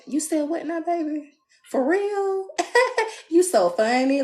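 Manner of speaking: high, sweet mock partner voice; high giggly lovestruck partner; lovey-dovey partner. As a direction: coo it like a smitten lover. Couple gazing, mock lovey quote.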